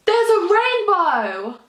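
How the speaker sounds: real delighted surprise; thrilled discovery. Real, not acted. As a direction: announce the rainbow in wonder. Spots a real rainbow.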